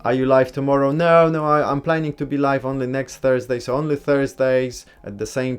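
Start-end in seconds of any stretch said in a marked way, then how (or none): none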